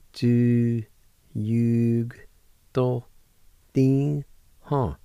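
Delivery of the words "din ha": usual speaking voice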